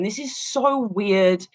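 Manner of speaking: normal talking voice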